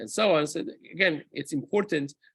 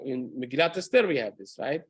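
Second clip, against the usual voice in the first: normal talking voice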